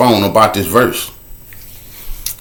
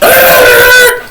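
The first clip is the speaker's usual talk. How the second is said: high, loud burst of laughter; loud laughing shout; 5 dB louder than usual. Real, not acted. Telling how they made curfew, a loud laughing whoop.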